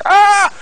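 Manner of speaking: high shrill imitation of a cat being whipped; mocking screech of a kid's choir. Child's choir singing like cats.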